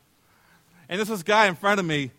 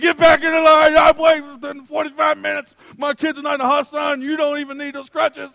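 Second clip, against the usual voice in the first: angry dad shouting at a line cutter in the sun; angry yelling; 9 semitones higher, 8 dB louder than usual. Retold or imitated. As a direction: bellow at him to get back in line. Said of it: "this guy's yelling at him".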